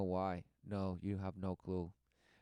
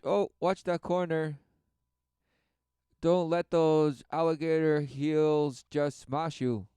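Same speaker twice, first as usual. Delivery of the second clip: ordinary talk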